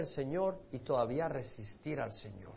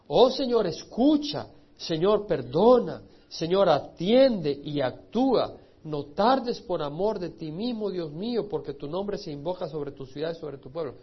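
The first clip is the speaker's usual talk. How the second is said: fervent pleading prayer; 7 dB louder than usual. Acted. Reads Daniel's cry aloud.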